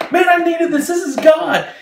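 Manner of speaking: very high, loud, overjoyed cry; retold elation. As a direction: cry out high, acting his overwhelmed joy. Man hugging, overwhelmed by prayer.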